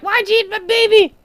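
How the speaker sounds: very high creature voice, upset; Yukuri voice. Retold or imitated. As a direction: squeak it out like a wounded little creature. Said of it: "the Yukuri was like".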